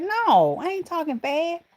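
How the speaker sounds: high-pitched mock denial; retold protest. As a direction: protest it high, as if answering your son. Acted retort to her son.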